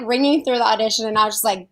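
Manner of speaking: ordinary talk, nothing marked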